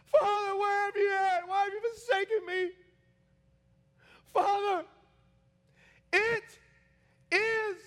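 very high, loud dying cry; full-force scream, each word torn out; anguished yell. Acted. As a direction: cry out to your father in agony. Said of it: "he yells out".

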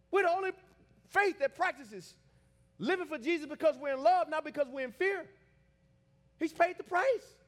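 high, loud, impassioned sermon; sustained pulpit shout; full-force preaching. Real, not acted. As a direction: shout it out to the whole congregation.

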